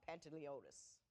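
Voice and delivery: usual speaking voice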